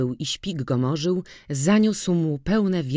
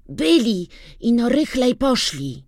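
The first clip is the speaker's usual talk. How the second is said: put-on boy's eager answer; 9 semitones higher, 4 dB louder than usual. A narrator's reading. Boy answers.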